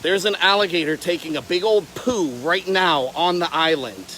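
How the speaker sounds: normal talking voice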